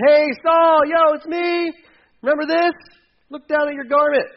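loud, high call across the distance. Retold or imitated. David calls out to Saul from the cave mouth, loud and high. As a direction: shout it up to the king so he hears.

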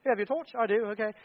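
playful British back-and-forth; mock British accent. Preacher acting out Britons asking for a torch, mock British accent. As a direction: put on a light British accent. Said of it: "If you're British, it's a torch".

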